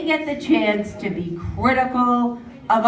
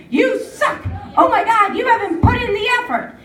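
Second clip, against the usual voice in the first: jeering commentator; 10 semitones higher than usual. Retold or imitated. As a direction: scold the athlete like a bitter ex-champion. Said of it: "be like".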